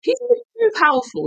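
very high, uneasy wondering; suddenly suspect the villain; high wary doubt. Retold or imitated. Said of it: "I was like".

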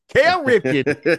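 Kale Ripken, slow, in a broad Baltimore accent. Shown as a demonstration. Host showing how Baltimore says the name, Baltimore accent. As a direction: drawl the name like a born Baltimorean. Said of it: "You gotta use the Baltimore accent".

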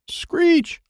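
high piercing screech; doll screech. A narrator's reading. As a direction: shriek like a broken toy.

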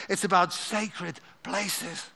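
normal talking voice

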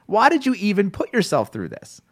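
high-pitched, incredulous mock scolding; exasperated retort. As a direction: scold an imagined viewer in disbelief. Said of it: "you're like".

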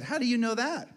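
imitated curious daughter; questioning daughter. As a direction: puzzle it out like a little girl. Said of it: "She said".

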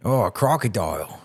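put-on amateurish Australian accent; playful, half-sure Australian twang; attempted Australian accent. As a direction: have a rough go at sounding Australian. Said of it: "how to do an Australian accent".

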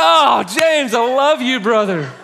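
overjoyed cry to a friend; delighted laughing shout. Retold or imitated. Pastor laughs out his love for James, loud and high. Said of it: "I'm like".

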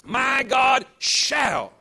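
slow, loud, stretched-out proclamation; leading the church in a loud, drawn-out declaration; declared loudly. Shown as a demonstration. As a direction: stretch each word and shout it out. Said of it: "Say it with me now".

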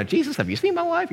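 high, squeaky-pitched protest; mock incredulous. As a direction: question it in comic disbelief. Incredulous Abraham questions Jesus.

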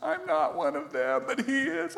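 high-pitched flustered protest; mock embarrassed. Preacher retells embarrassment over a girl's remark. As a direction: squeak out an awkward denial. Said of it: "I felt so bad".

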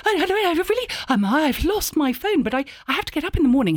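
high, flustered posh babble; stammer, then gush in a high voice; awkward over-polite rambling, pitched high; verbal diarrhea. Retold or imitated. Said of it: "I get utter verbal diarrhea".

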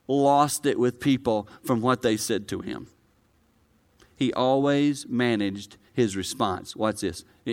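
ordinary talk

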